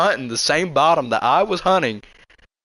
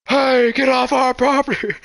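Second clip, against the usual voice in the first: much higher and louder angry yell; retold scream; 9 semitones higher, 4 dB louder than usual. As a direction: shout them off the land. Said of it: "They're screaming at us".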